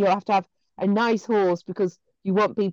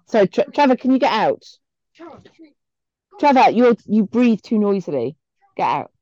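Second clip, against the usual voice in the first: raised voice telling Trevor off; shooing someone out; 4 semitones higher, 4 dB louder than usual. Real, not acted. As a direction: call out firmly, half joking. Shooing son out of room.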